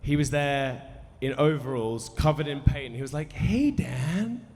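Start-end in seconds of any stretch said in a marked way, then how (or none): 3.3-4.5 high, loud, badly imitated American accent; mock American greeting, pitched way up and called out; David Lynch. Film director welcomes his guest in, hammed-up American accent. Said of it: "Sorry for my American accent".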